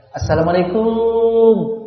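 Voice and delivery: "Assalamualaikum" pelan, "kum" dipanjangkan seperti hormat; panjang pendek salah. Shown as a demonstration. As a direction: salam lambat yang berlebihan. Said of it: "tapi tetap salah panjang pendeknya".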